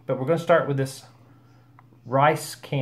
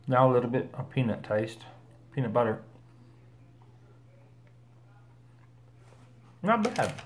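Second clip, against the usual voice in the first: speaking between bites; talking while chewing; 3 semitones lower, 4 dB quieter, faster than usual. Real, not acted. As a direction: mouth partly full of bar. Seen 1: holds bitten bar throughout, bites again at 10.7, talks between bites.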